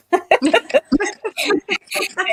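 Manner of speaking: high-pitched burst of laughter; real giggling laugh at herself. Real, not acted. Laughing about going alone to haunted locations, amused. Laughs at her own remark.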